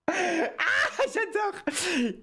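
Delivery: delighted giggle at her own guess; real laughing delight. Real, not acted.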